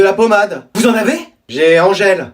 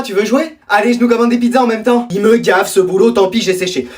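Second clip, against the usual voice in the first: normal talking voice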